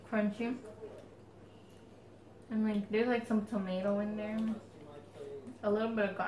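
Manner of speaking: eating between words; talking while chewing chips. Real, not acted. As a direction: mouth full of crunchy snack. Seen 2: Both women hand chips to mouths and chew throughout the labelled words.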